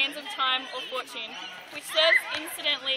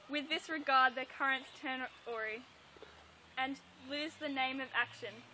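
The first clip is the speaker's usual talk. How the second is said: soft, measured verse reading; subdued soliloquy recital; 2 semitones lower, 8 dB quieter than usual. Acted. Student closing Hamlet's soliloquy, quiet recitation. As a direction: let the speech sink into quiet.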